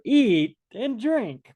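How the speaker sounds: high, slow coaxing host voice; pushy host. Retold or imitated. Selfish ruler urging his guest to eat, high and slow. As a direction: draw it out high like a host pushing food. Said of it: "He says to you".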